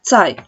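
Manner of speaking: tsai said short and clear; teacher models tsai. Shown as a demonstration. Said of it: "acá tenemos el tono".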